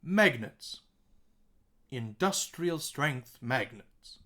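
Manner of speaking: "Magnets" punched on MAG, then "Industrial strength magnets" slowly; stressed syllables demo. Shown as a demonstration. Teacher asking students to listen again, stressed syllables stressed. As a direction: land hard on MAG, DUS and STRENGTH, slowly. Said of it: "Listen again".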